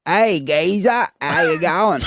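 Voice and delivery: slow cheeky London geezer greeting; a Cockney bloke saying hello, drawn out; English geezer accent. Pretend. To the hosts, a put-on English geezer accent. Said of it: "I could do an English person".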